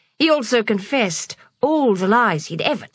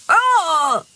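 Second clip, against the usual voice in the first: high, drawn-out oh; dismayed cry; 8 semitones higher, 4 dB louder than usual. A narrator's reading. Benny gets sprayed by the skunk, high dismayed cry. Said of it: "cried Benny".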